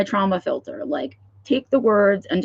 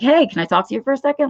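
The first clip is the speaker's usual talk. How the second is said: fake casual friendliness; 7 semitones higher, faster than usual. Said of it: "they make it like".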